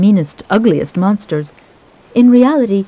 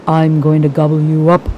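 lightly voiced troll threat; troll threatens the big goat; 3 semitones lower than usual. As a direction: menace as the troll once more. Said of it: "troll threat repeated".

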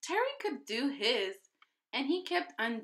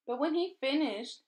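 ordinary delivery, nothing marked

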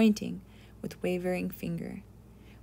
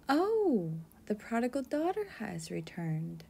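mother's exclamation; 3 semitones higher than usual. Retold or imitated. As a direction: imitate a mother greeting her returning daughter, voice leaping up. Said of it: "my mother said".

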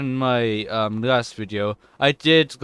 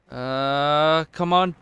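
ordinary talk

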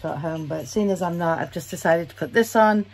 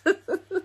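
bright burst of laughter; high giggle; 12 semitones higher than usual. Real, not acted. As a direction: laugh at yourself. Laughing at her leisure suit.

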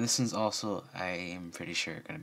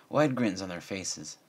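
usual speaking voice

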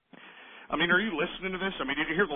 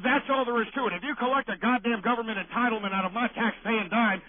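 normal talking voice

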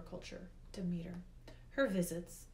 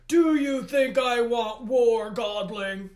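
slow, very loud shout; Hades bellowing; 4 semitones higher, 16 dB louder, slower than usual. A narrator's reading. Hades rebukes the godling about war, bellowing. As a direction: bellow it like an outraged god. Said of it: "Hades bellowed".

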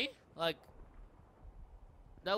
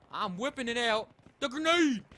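louder, higher, ending in a yell; building shout; 4 semitones higher, 9 dB louder than usual. Real, not acted. Hyped announcement, building to shout.

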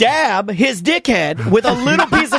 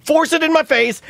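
higher-pitched mock exasperation; retold exasperation; 6 semitones higher than usual. Man tells off his unhelpful friend, retold exasperation. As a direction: replay an impatient telling-off. Acted exasperated reply to Butler.

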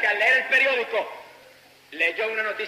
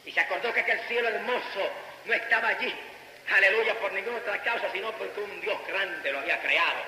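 ordinary talk

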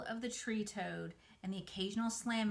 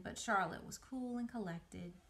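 ordinary delivery

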